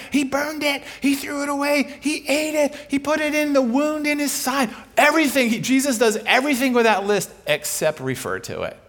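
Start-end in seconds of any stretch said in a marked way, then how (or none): 0.1-4.7 high-pitched, excited student shouts; imitating students screaming out. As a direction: call each answer out like a roomful of students. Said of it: "students just start screaming out".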